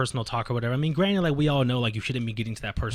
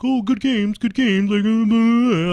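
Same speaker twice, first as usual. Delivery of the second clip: high, loud mock sing-along of empty niceties; mocking players' politeness; 10 semitones higher, 10 dB louder, slower than usual. Player mimicking everyone's boring post-game chatter, loud high mockery. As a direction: parrot them in a high, loud put-on voice.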